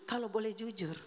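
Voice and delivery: normal talking voice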